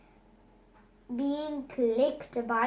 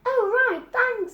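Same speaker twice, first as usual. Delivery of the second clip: put-on reluctant boy; 10 semitones higher, 6 dB louder than usual. Bertie reluctantly thanking.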